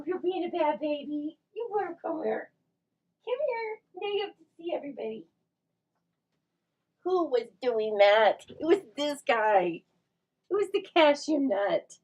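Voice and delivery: high sing-song telling-off to the dog. Real, not acted. Playfully scolding the dog.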